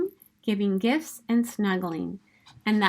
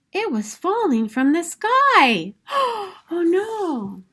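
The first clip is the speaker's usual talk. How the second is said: higher, louder, slow alarm then a gasp; 8 semitones higher, 5 dB louder, slower than usual. Acted. Buddy sees Santa's sleigh falling, alarmed gasp.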